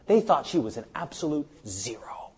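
hushed, breathy confiding aside; whispered aside to the congregation. Real, not acted. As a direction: drop to a whisper as if sharing a secret. Aside about the crowd's contempt.

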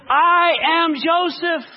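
loud, high, very slow declaration; emotional reveal. A narrator's reading. Joseph reveals himself to his brothers, loud slow cry. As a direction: announce yourself with overflowing feeling.

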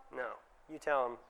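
normal talking voice

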